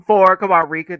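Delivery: loud coaxing call to the pet. Real, not acted. Calling the dog over to pick four, loud and eager.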